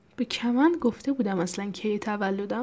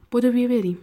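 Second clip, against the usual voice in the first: louder, higher urging; urgent call; 4 semitones higher, 7 dB louder, faster than usual. Acted. Grabbing Kamand's hand to run from the fire, urgent call. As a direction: hurry them along, raised voice. Urging a companion to flee arson.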